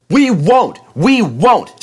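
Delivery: high, loud screaming refusal; stubborn trucks screaming at James, high and loud. Acted. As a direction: shriek back defiantly. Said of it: "screamed the trucks".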